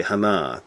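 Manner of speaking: "Hannah" as hah-NAH; Hebrew-style "Hannah", second syllable stressed; Hebrew pronunciation. Shown as a demonstration. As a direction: open "ah" vowels in "Hannah", stress on the end. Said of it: "when I first used to say".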